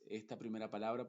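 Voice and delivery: ordinary delivery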